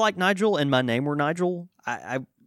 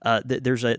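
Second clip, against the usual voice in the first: ordinary talk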